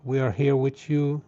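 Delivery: normal talking voice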